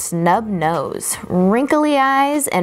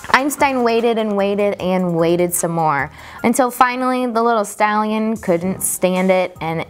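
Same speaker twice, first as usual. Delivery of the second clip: plain reading voice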